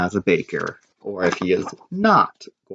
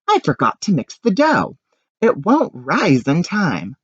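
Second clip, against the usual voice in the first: panicked realization; 8 semitones higher than usual. Acted. Anthony panics.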